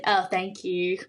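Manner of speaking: put-on accidental Aussie-accented thanks; Australian accent. Swimmer thanking someone handing her a towel, Australian accent. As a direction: slip into a light Australian accent. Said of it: "I start doing the accent".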